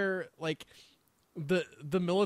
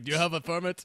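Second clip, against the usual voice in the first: put-on Russian accent. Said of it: "I'm doing a Russian accent".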